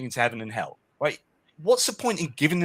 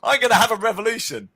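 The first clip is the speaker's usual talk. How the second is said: higher, louder mock rebel declaration; defiant rebel; 9 semitones higher, 5 dB louder than usual. Lucifer announcing he will have a revolution, mock defiant. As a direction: blurt it out like a cocky rebel. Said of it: "you can't go".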